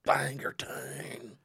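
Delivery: breathy whispered answer; whispering. Pretend. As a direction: whisper the town name.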